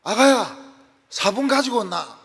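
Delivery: loud, high Gyeongsang call; Gyeongsang mother-in-law. Retold or imitated. Mother-in-law calling her Seoul daughter-in-law, loud Gyeongsang dialect. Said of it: "경상도 시어머니가 서울 며느리 보고".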